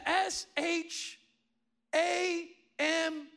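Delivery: retold careful spelling; spelling out letters. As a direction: spell it out with drawn-out, separated letters. Wife spelling out dreamed letters.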